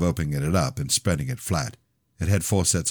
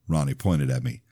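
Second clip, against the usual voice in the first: plain reading voice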